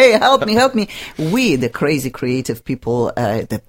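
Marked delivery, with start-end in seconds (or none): 0.0-0.9 higher-pitched pleading, quick repeat; mock plea. People pestering Oprah for help, mock pleading.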